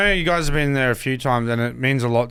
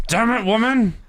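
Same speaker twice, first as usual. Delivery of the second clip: raised cartoon voice, exasperated husband; Simpsons impersonation; 9 semitones higher than usual. Guest answering the nagging wife in character, Simpsons impersonation. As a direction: play the fed-up cartoon husband, pitched up high. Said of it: "gotta get into character".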